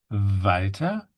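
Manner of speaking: "Walter" as VAL-tuh, with a buzzing v; German W. Shown as a demonstration. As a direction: start "Walter" on a long voiced v. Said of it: "to use the v".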